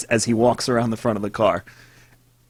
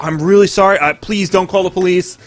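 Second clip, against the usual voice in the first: higher-pitched imitated pleading; frantic apology; 7 semitones higher than usual. Gunman begging driver not to call police, frantic apology. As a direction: rush out apologies on a raised pitch. Retold gunman begs forgiveness.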